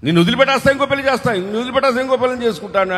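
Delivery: high, fast mock threat of a husband; mocking threatening husband. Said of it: "అనేవాళ్ళు లేకపోలేదు". (భార్యను బెదిరించే భర్తను వెక్కిరిస్తూ, ఎత్తైన వేగమైన గొంతుతో)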